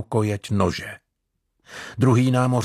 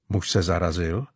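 ordinary delivery

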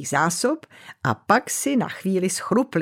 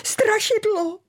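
much higher scared shout; frightened cry. Acted. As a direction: yelp out the alarm. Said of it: "vykřikl Bodlinka". (vyděšený výkřik)

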